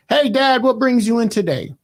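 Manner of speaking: high, loud, bright-eyed mock friendliness; cheery guard greeting. Security guard greets the uncle loudly, sunny and high. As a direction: chirp it like an overly chipper nurse.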